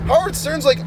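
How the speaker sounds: ordinary talk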